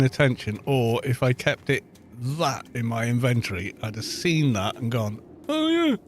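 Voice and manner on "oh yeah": high, bright "oh yeah" of remembering; sudden realization. Retold or imitated. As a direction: act the lightbulb moment. Imagined realization in inventory.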